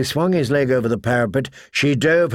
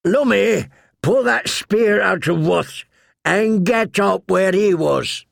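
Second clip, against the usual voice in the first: shouting orders; 8 semitones higher than usual. A narrator's reading. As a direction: bark battle orders. Yoren commands in battle.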